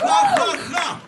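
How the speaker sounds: high, loud mock evil laugh; a big stagey villain's cackle; Putin's laugh. Putin laughing, high and loud, a mock cackle. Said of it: "Putin laugh like this".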